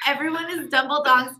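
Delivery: ordinary talk, nothing marked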